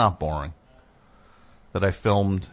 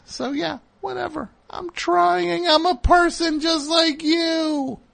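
pleading outburst; slower than usual. Real, not acted. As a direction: cry out that he is only human. Pleading outburst about being human.

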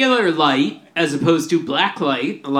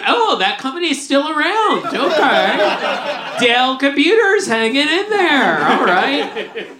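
mock surprise; 7 semitones higher than usual. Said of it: "It also makes me go like".

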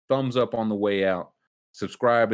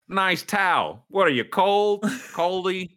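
mock heckling; 10 semitones higher, slower than usual. Mocking a player courtside.

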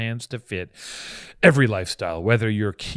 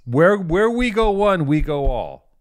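slow, high, loud proclamation; drawn out like a grand motto; dramatic slogan; 7 semitones higher, 6 dB louder, slower than usual. Pretend.

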